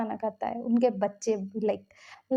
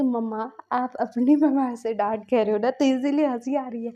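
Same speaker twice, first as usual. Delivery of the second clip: put-on light childish teasing voice, a bit louder; kids teasing; 5 dB louder than usual. Twin kids teasing their mom, in a light childish voice. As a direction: tease like a giggly little kid.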